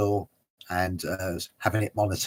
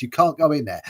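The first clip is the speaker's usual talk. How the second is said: raised mock objection; mock heated; 5 semitones higher, 5 dB louder, faster than usual. Taxi driver refusing the passenger entry, mock heated. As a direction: imitate an exasperated driver. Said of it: "it got heated a bit".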